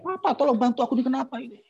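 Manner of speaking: high-pitched imitation of a panicked wife; retold cry for help. Wife calls husband from the toilet, mock panicked cry. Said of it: "teriak-teriak".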